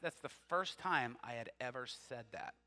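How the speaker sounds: ordinary talk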